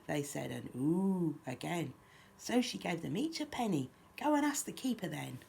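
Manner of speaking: lively, lilting picture-book reading; children's story narration. A narrator's reading. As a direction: read a rhyme aloud to little ones. Reads picture book aloud.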